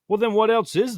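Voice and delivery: loud, high, challenging outburst; heated shout. Real, not acted. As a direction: fire the question back, heated. Heated argument, rising challenge.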